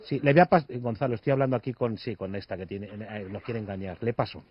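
turned away from the phone; off-mic aside. Acted.

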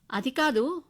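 cut-off protest. Acted. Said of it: "అంటూ ఆగిపోయాను".

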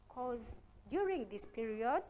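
ordinary delivery

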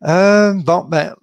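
raspy, strained throat; hoarse voice. Real, not acted. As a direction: voice catching on a frog in the throat. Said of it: "j'ai un chat dans la gorge".

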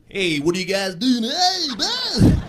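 muddled mix of Alabama and Brooklyn accents. Shown as a demonstration. Guy unsure where he's from greeting buddies, Alabama-Brooklyn accent. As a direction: waver between Brooklyn and Alabama accents, climbing high. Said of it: "doesn't know if he's from Alabama or Brooklyn".

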